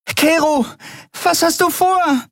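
high, strained call; calling into wind. A narrator's reading. Nelen asks Kero at the open door, calling out. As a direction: cry it out against the wind. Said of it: "Der Wind verschluckte ihre Frage".

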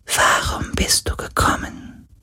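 soft tearful ghostly whisper; weeping spirit barely audible; crying whisper. A narrator's reading. Grieving ghost.

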